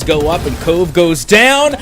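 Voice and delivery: loud, punched caster call; excited play-by-play. Real, not acted. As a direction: burst out as the kill lands. Live call of round-winning kill.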